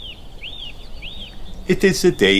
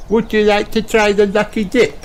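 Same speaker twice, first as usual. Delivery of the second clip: high eager stallholder pig; squeaky piggy sales pitch; Peppa voice; 13 semitones higher, 3 dB louder than usual. A narrator's reading. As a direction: invite him brightly like Peppa. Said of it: "asks Peppa".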